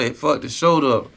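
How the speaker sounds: ordinary talk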